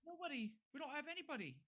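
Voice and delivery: very high, anxious villager voice; frightened pleading. Acted. Scared villagers denying they hide anyone, pleading.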